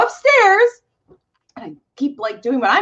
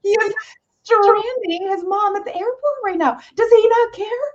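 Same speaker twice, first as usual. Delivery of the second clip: worked-up rant; 9 semitones higher than usual. Retold or imitated. Worked-up rant about husband.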